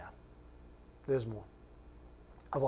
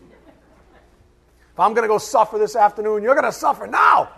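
slow, loud, higher mock rant, laughing at the end; mock angry shout; 6 semitones higher, 10 dB louder, slower than usual. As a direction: vent your angst in playful fury. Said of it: "I gotta get all my angst out".

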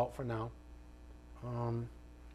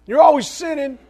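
higher and louder, hitting "always" hard; emphatic exclamation; 10 semitones higher, 10 dB louder than usual. Real, not acted. Preacher to congregation, an emphatic louder cry.

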